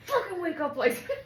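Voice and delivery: high mock fury with laughter in it; loud, giggly mock rage; retold angry outburst. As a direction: imitate a furious scolding while cracking up. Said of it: "He was so mad".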